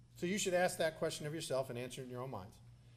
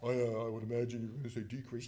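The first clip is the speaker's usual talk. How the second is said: low-pitched mock official; Carlos Del Toro; 6 semitones lower, 3 dB quieter than usual. Navy secretary answering the congressman's question, mocked. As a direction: play a clueless bureaucrat in a low voice. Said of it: "he talks like this".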